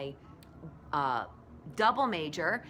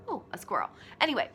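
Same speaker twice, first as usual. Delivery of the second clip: usual speaking voice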